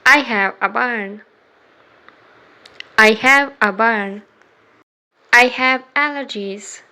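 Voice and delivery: ordinary talk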